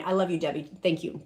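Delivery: ordinary talk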